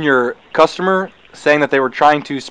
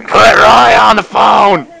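yelling; 6 semitones higher, 5 dB louder, much slower than usual. Pretend. Yelling at phone from background.

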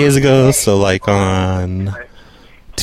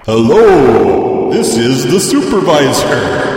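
slow, drawn-out echoing supervisor voice; fake supervisor; much slower than usual. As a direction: stretch the words like a bogus supervisor. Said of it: "Let me get the supervisor".

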